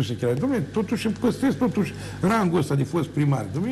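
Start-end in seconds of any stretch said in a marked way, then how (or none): none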